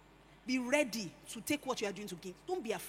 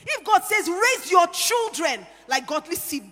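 loud, slow preached command; preaching shout; 5 semitones higher, 11 dB louder than usual. Real, not acted. Preacher shouting to the congregation about raising godly children. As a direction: thunder it out to the women.

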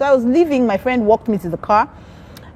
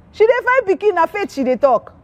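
high, lively Nigerian Pidgin remark; 10 semitones higher than usual. Retold or imitated. Friend marvelling at the husband's faith in Pidgin. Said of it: "That's why I'm speaking in pidgin".

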